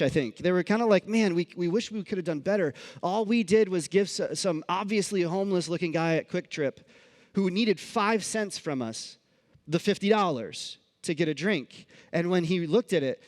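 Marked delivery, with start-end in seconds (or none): none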